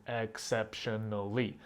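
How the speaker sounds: exceptionally said slowly, each syllable apart; slow syllable split. Shown as a demonstration. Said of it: "five syllable words like".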